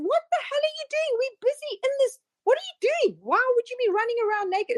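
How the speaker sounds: the shocked mind yelping at you; startled inner voice. Retold or imitated. Voicing your own startled mind.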